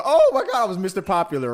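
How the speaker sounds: high, bright excited gasp; excited gush. Retold or imitated. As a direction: gush like you just got famous. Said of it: "I was like".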